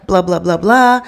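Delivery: ordinary delivery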